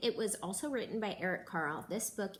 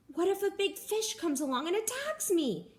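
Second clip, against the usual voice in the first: high, anxious crab voice; worried crab; 10 semitones higher than usual. A narrator's reading. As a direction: fret about the big fish. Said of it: "he thought".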